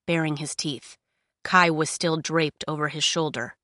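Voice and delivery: plain reading voice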